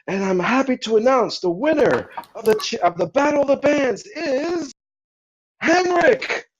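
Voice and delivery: high MC voice building to the winner's name; event announcer. Acted. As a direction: hold the suspense, then hit the name high. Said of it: "You see the announcer come up".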